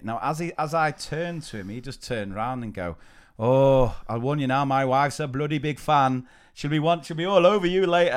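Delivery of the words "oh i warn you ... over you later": higher, louder put-on Welsh accent; Adam Jones. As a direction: put on an apologetic attempt at Welsh. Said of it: "again, apologies about the accent".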